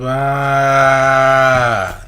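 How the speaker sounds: long, loud, held "aah"; sustained reacting holler; loud drawn-out "aah". Real, not acted. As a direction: hold one loud cry in reaction. Reacting to the played clip.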